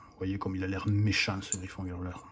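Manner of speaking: hushed whisper. Real, not acted. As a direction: whisper it close to the mic. Showing the monster card.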